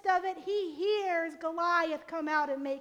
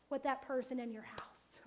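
whispered; 6 semitones lower, 9 dB quieter than usual. Acted. Hushed ending of question.

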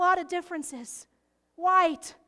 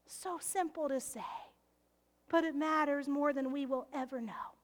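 much softer, dropping to a whisper; soft earnest whisper to the congregation; hushed; 3 semitones lower, 8 dB quieter than usual. Real, not acted. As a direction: lean in and hush it. Drops to whisper for emphasis.

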